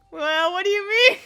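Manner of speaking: high, loud, incredulous comeback; loud exasperation. Real, not acted. Streamer to viewer asking what is moving, loud exasperation.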